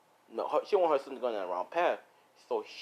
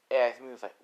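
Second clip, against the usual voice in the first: ordinary delivery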